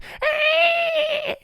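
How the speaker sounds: very high sustained mimicked squeal; imitated piercing little-boy shriek; high-pitched squeal. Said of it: "'cause he's a high-pitched little boy".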